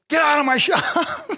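high, slow, laughing get out; laughing mock order. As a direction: throw him out while laughing. [name] chases him out.

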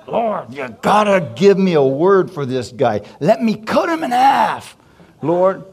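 slow, loud heated prayer, pitch climbing high; angry plea. Retold or imitated. Preacher begging the Lord for a cutting reply, heated and loud. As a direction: demand a cutting word from God.

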